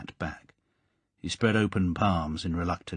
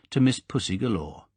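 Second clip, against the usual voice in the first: usual speaking voice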